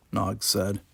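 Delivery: ordinary delivery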